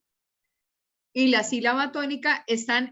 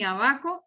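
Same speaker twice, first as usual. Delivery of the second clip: "abaco" stressed on the middle syllable BA; wrong middle stress. Shown as a demonstration. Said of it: "Yo no digo ni abaco". ("abaco" con acento en la penúltima, a-BA-co)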